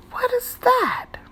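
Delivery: high wondering question; curious surprise. A narrator's reading. Pete spots something in rocks.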